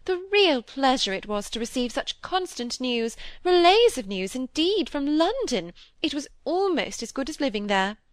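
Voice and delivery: high-pitched, delighted society gush. A narrator's reading. Mrs Gibson boasts to Hollingford of London news, gushing. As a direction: show off with exaggerated pleasure.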